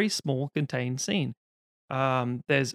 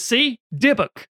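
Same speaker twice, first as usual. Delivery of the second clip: triumphant wife; 7 semitones higher, 5 dB louder, slower than usual. Retold or imitated. Wife proves her point.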